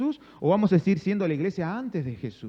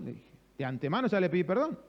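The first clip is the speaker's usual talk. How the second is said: normal talking voice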